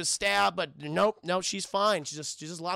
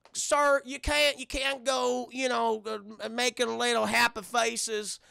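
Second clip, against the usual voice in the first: mock Southern drawl; Southern accent. Barman objecting to carved bar stools, Southern accent. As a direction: protest in a put-on Southern accent. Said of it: "has a southern accent".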